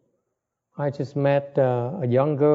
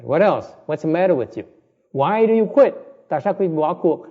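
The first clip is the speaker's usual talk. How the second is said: louder, higher challenging questions; scolding; 6 semitones higher, 7 dB louder than usual. Real, not acted. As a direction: demand it of them, rising in force. Teacher scolding quitters. (hỏi dồn, to tiếng trách móc)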